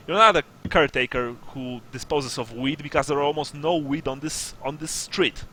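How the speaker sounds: emphatic, no-nonsense ultimatum; stern sergeant. Acted. Sergeant demands fifty gold crowns from smugglers, stern. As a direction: lay down terms, pressing each point. Sergeant sets new price.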